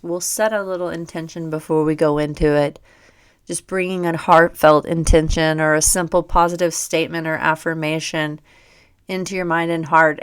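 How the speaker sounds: usual speaking voice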